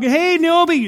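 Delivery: high, loud, cheery hello; gushing greeting. Acted. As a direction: call out gladly before a hug. Acted greeting before a hug.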